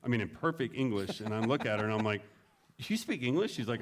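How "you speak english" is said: high-pitched, incredulous question; retold disbelief, pitched up; astonished question. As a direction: act out being taken aback. Retells his amazement at her English.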